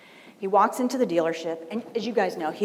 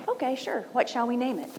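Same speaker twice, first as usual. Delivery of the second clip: higher-pitched, agreeable character reply; agreeable pig; 6 semitones higher than usual. Acted. Pig answers chicken's restaurant idea, agreeable. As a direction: lightly voice a pig going along with it.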